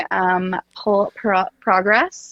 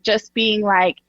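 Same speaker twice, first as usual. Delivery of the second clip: usual speaking voice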